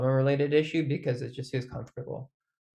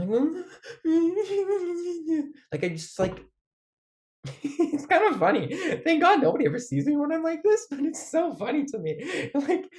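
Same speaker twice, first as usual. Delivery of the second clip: high, laughing half-cry; giggling and teary at once; tearful laughter. Real, not acted. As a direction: laugh through near tears. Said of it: "Oh, fuck it, I'm bawling".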